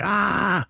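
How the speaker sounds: high-pitched mock "ah" of pain; imitated squeal at a gory cut; retold squeamish cry. Acts own wince at gory cut.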